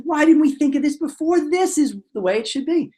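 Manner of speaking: high, eager mock realization; hammed-up pleased discovery; acted delight. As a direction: marvel at how obvious the idea is. Acted delight at obvious solution.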